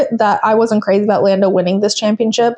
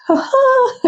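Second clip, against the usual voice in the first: a squeaky, very high "ha ha" giggle; high-pitched Lando laugh. Retold or imitated. Imitating Lando's giggle on the radio, very high squeak. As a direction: mimic his laugh way up in a squeal. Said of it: "really high pitched".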